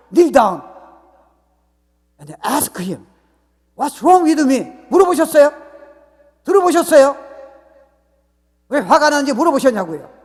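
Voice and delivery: high, loud challenge building to a shout; full-force preaching. Real, not acted. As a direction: hammer each question at the congregation. (언성을 높여 다그치듯 외치는 설교)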